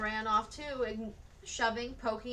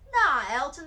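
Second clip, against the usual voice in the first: put-on dismissive boy; 4 semitones higher, 9 dB louder than usual. A narrator's reading. Other boy correcting loudly.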